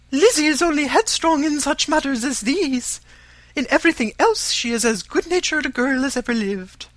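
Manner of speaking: high, loud, fussy matron; shrill-free but high and insistent mother; Mrs Bennet's voice. A narrator's reading. To Mr Collins, an eager high mother's voice.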